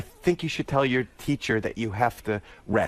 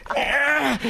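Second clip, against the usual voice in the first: swing whoop; 13 semitones higher than usual. Retold or imitated. Dad on a swing with his little girl, whooping high. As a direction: whoop like you're soaring on a swing.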